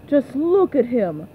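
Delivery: normal talking voice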